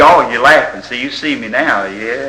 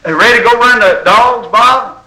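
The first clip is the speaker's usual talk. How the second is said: high, loud call to a friend; buddy hollering an invitation; hunting buddy calling; 11 semitones higher, 4 dB louder than usual. Retold or imitated. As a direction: call out cheerily to your buddy. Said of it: "guy say".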